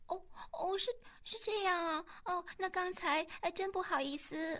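音高而轻，吞吞吐吐地赔不是; 捏细嗓子，轻声结巴着道歉; 尴尬道歉的女生. Acted. 李梅得知误会后尴尬道歉. (又细又轻、不好意思的女声)

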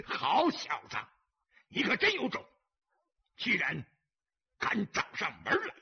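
boisterous boss's jeer; loud, amused bravado. A narrator's reading. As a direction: call it out with mocking admiration. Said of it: "忽然大声笑道".

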